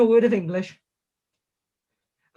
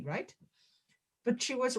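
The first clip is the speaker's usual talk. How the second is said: normal talking voice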